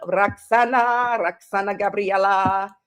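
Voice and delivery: slow, grand drawn-out full name; dramatic name drawl. Shown as a demonstration. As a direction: stretch Roxana Gabriela out and savor each syllable. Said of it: "I always have to say it like that".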